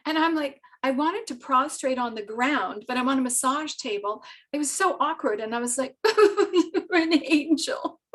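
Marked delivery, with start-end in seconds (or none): 6.2-8.0 high, slow, loud mock wail; mock crying. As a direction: wail it out, half sobbing, half laughing. Retold breakdown, wailed to the angel.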